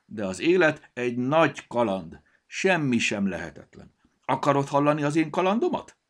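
high, loud, enthusiastic pitch; excited. A narrator's reading. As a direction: sell the adventure, building to an eager question. Excited book offers its adventure story.

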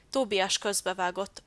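usual speaking voice

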